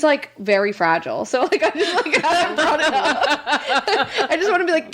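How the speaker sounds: normal talking voice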